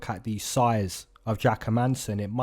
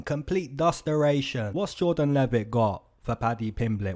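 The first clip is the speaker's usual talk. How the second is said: normal talking voice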